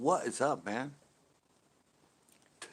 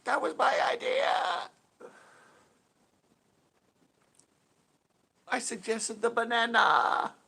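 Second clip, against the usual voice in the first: indignant claim; 7 semitones higher, slower than usual. Retold or imitated. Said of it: "retells claiming the idea".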